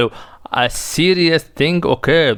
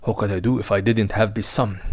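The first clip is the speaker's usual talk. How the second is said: softer, lower muttered aside; worried aside; 6 semitones lower, 6 dB quieter than usual. Acted. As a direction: grumble it under your breath. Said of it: "S S aside".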